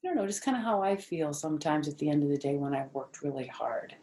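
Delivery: ordinary delivery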